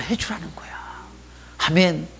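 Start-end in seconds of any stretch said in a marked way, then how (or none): 0.0-0.7 soft confiding whisper. Real, not acted. Pastor whispering instruction to the congregation. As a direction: lean in and whisper it.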